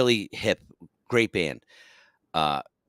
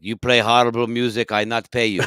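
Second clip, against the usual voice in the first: angry Russian club owner, louder; Russian accent; 3 semitones higher, 7 dB louder than usual. Retold or imitated. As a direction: put on a blunt Russian accent. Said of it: "Russian guy".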